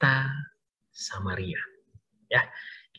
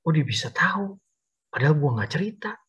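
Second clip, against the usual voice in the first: wondering aloud; 5 semitones higher than usual. Acted. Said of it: "katanya gitu ya".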